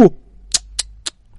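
disapproving tongue clicks; tut-tut-tut with the tongue. Acted. Şakro clicking his tongue at his companion, disapproving. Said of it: "oflayıp pufluyordu".

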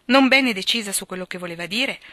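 ordinary delivery, nothing marked

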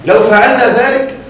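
ordinary delivery

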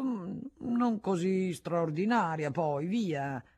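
slow, drawn-out disdainful hum; mild sneering put-down; dismissive grunt. A narrator's reading. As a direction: shrug it off with a sneer. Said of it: "Sisi fece un verso".

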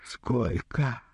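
thin, drawn-out despairing question. A narrator's reading. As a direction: ask it with helpless despair. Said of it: "В голосе водопроводчика слышалось отчаяние". (вопрос с отчаянием)

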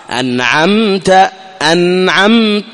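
أنعمت slow, the meem a brief lip closure; short clear meem. Shown as a demonstration. Teacher demonstrates the brief meem, slow and clear. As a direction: lips just touch on the meem of أنعمت. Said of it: "مجرد أنك تقول م".

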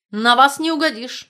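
loud, impatient retort. Acted. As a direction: snap back, out of patience. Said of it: "нетерпеливо ответил Альтман". (нетерпеливо)

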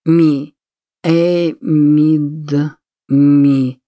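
put-on fumbling the name, drunk; loud, slurred stumble over a name; slurred drunk speech. A narrator's reading. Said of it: "Совсем пьян".

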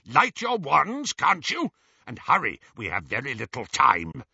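a goblin hurrying the humans along; impatient goblin. Acted. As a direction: goblin voice pressing them to hurry. Griphook urges them on.

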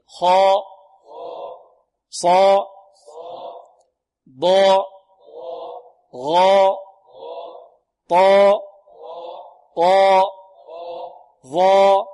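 loud, held, heavy vowels on "خاء، صاء، ضاء، غاء، طاء، ظاء"; heavy tafkhim. Shown as a demonstration. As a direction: drill "خاء، صاء، ضاء" thick and loud, lips unrounded. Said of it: "هكذا بدون ما-، بدون ماذا؟". (تفخيم "خاء، صاء، ضاء، غاء، طاء، ظاء" بدون ضم الشفتين)

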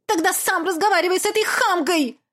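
high, sharp indignant retort; angry mother. Acted. Mother snapping at Olaf, high and cutting. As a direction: spit the insult out.

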